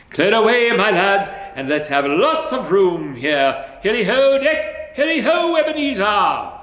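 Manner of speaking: loud, high festive shout; jolly cry. A narrator's reading. Fezziwig calling the lads to stop work, loud and jolly. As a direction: announce the holiday to the lads.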